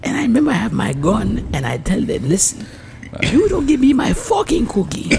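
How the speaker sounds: mock Haitian accent. Acting the gun-waving cookie customer, mock Haitian accent. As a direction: threaten them in a hammed-up Haitian accent. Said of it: "do a Haitian accent".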